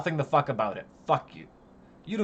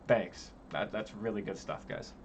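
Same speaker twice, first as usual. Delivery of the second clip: low, soft deadpan sarcasm; sarcastic thanks; 8 semitones lower, 12 dB quieter than usual. Real, not acted. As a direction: thank them with dry contempt, fading.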